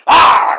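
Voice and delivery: loud, high mock yell; a yelped cry. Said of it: "He just went".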